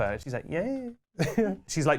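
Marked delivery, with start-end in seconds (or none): 0.5-0.9 soft, higher, noncommittal reply; small unenthused mock response; Destiny Harold. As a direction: imitate an indifferent shop worker. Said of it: "utterly neutral expression of Destiny Harold".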